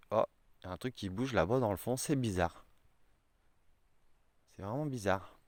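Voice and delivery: softly, as if watching something move in the distance; hushed, distracted. Real, not acted. Vlogger noticing something moving behind him, hushed and puzzled.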